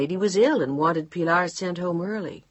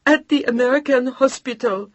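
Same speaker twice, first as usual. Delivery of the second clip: sobbing; 8 semitones higher, 8 dB louder than usual. Acted. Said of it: "The old woman was sobbing now".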